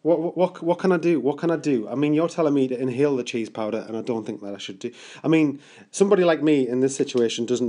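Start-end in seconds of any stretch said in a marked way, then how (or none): none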